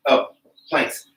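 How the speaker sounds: ordinary delivery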